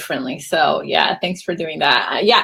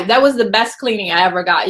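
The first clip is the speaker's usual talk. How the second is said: ordinary talk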